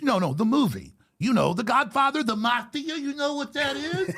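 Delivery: hammed-up condescending gangster; patronizing mobster. Mobster explaining the movie, hammed-up patronizing wiseguy. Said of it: "And he goes".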